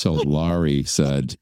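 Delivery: normal talking voice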